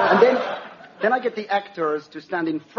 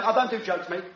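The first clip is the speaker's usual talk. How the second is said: cockney comic; faster than usual. Acted. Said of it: "that crazy cockney".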